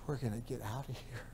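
imitating mother's hushed whisper; very soft, secretive whisper; urgent whisper. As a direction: whisper it to a waking child, fading away. Mother secretly waking children to flee.

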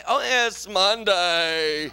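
very slow drawn-out groan; mock whine. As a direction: moan it like Monday is torture. Mocking Monday complaint.

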